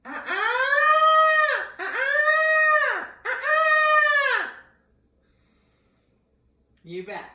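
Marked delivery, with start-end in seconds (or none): 0.0-4.5 very high, loud repeated squawks; bird call. Shown as a demonstration. Voice teacher demonstrating her own bird, piercing calls. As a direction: screech it out like a bird. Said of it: "Finding your own bird".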